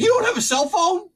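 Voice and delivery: high-pitched disbelief; incredulous. Retold or imitated. Worker quizzes guy hunting a flashlight, high incredulous question. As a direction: ask it high, as if astonished. Incredulous retort in retold argument.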